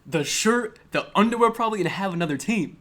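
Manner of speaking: loud, high list, item by item; outraged rant. Real, not acted. Podcaster incredulous at two-team Rams fan, outraged rant. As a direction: pile up each item in comic outrage.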